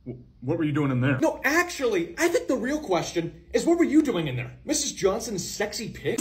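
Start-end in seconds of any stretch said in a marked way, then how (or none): none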